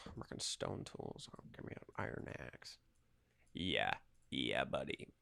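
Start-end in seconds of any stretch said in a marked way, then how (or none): none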